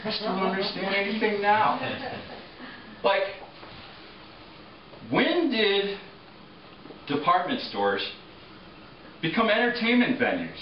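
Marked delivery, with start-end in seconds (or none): none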